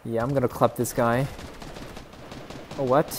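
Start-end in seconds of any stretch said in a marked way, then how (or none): none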